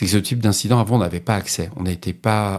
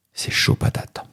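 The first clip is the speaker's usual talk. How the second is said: whispered slang aside; slangy aside; 4 dB quieter, slower than usual. Shown as a demonstration. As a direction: toss off a slangy joke under the breath. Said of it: "Il faut que je le coupe au montage".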